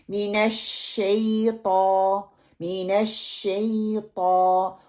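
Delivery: slow मिनश शैता, held SH, heavy TAW; full sheen. Shown as a demonstration. Teacher modelling मिनश शैता correctly, held sheen. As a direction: draw out SHAY and round the final TAW of शैता. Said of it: "ये वाला sound निकले".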